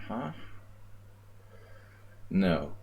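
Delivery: ordinary talk, nothing marked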